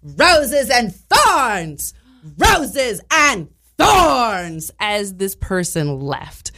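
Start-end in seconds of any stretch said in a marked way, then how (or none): 0.0-4.7 dramatic exit line. Retold or imitated. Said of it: "the final line of this fight was".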